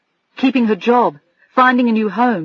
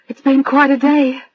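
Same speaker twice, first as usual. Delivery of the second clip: higher, tired and tearful; weak smile through tears; 6 semitones higher than usual. Acted. Adrian answering Bill's joke, a meagre tearful smile. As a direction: force a feeble smile. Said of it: "she tried to smile, but it was a meagre effort".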